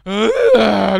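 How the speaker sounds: very loud, ugly bray; loud braying as bad singing; donkey singing attempt. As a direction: blare a dreadful note. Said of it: "dia nyanyi".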